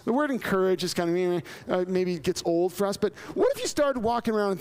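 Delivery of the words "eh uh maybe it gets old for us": usual speaking voice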